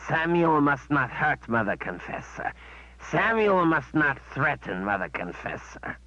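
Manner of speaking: dutiful beast repeating its rules; creature servant reciting. Acted.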